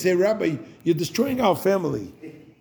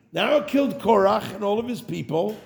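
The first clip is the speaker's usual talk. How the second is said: usual speaking voice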